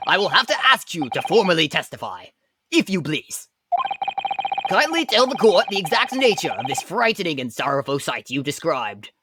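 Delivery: ordinary delivery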